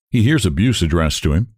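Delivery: ordinary delivery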